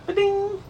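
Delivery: high sparkly ding; bright chime sound effect; coin chime sound. Pretend. Sparkling clean car wash gag.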